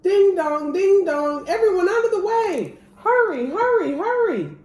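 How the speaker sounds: loud, high bell and shouts; siren call. A narrator's reading. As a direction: clear the road, bell ringing. Fire engines racing through streets.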